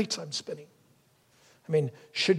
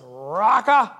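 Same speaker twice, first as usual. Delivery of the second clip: raised, crow-like mock shout; shouted insult; 4 semitones higher, 8 dB louder than usual. Preacher mimicking insults at those who disagree, mock shout. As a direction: fling the word out loud and high. Acts out calling opponents an insult.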